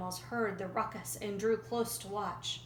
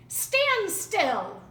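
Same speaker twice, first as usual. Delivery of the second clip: high loud snapping shout; angry cry; 9 semitones higher, 8 dB louder than usual. A narrator's reading. Rattlesnake yelling as Roadrunner dodges. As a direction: yell it as he lunges. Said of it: "Rattlesnake cried".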